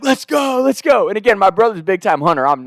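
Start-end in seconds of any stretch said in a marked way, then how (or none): 0.0-1.1 high, excited cheer at normal volume; imitated celebration. Brothers jumping up and down, excited. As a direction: re-enact a giddy celebration.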